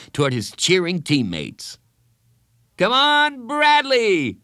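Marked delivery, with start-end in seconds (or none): 2.8-4.4 high, loud yell. Acted. Someone yelling Bradley on, loud cheer. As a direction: cheer him on hard. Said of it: "they all yelled".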